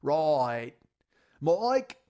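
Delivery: loud mock British-Aussie yelp; hammed-up accent to annoy someone; obnoxious put-on accent. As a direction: holler in a silly accent, leaping up in pitch. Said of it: "run from the fucking computer screaming".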